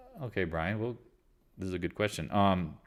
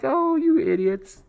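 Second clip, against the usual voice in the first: high, drawn-out mocking jeer; high-pitched, slow taunt; mocking imagined quote; 4 dB louder, slower than usual. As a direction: stretch it out high in mock scorn. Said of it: "He's like".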